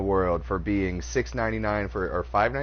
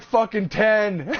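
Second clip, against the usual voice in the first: slow, high, louder mock Mike; imitating Mike; 10 semitones higher, 6 dB louder, slower than usual. Mike admitting his late shift, mock sulky. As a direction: drag it out like a guilty shrug. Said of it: "He's like".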